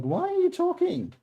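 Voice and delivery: high, whiny cat voice; voicing the cat. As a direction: speak for the cat, high and needy. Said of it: "and you go like".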